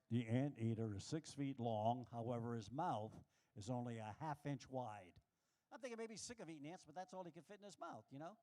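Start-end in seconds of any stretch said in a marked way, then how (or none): 0.1-5.2 low, slow documentary narrator voice; lower, deliberate nature-show read; narrator impression. Comic quoting the TV narrator on anteaters, low documentary voice. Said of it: "Narrator said this".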